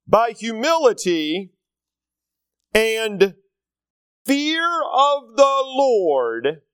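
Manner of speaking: very slow, weighty verse with long pauses; slow, deliberate proclamation; solemn scripture reading. Real, not acted. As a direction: draw out each phrase of the verse. Reads scripture aloud to congregation.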